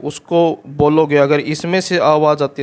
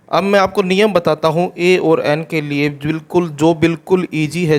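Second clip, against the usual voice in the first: usual speaking voice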